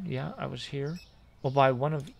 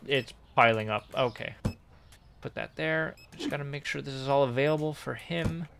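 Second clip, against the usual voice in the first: usual speaking voice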